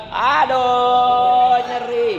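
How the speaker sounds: loud, high "Aduh, nyeri"; hammed-up cry of pain; mock complaining cry. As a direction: yelp like a whiny patient. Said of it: "kalau kita makan".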